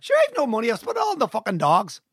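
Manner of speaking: high, sing-song Donegal accent. Shown as a demonstration. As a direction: deliver the line in a Donegal accent. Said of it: "No, that's Donegal".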